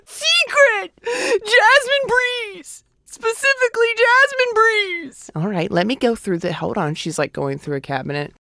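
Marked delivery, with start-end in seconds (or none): 0.1-5.3 very high, drawn-out pleading cries; teen begging mom for fancy deodorant, squealing; whiny teen demand. Retold or imitated. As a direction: whine it like a desperate kid. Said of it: "And I'm".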